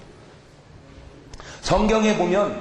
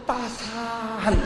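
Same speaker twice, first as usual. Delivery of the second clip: soft savoring; 4 dB quieter, much slower than usual. Shown as a demonstration. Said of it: "영어로는".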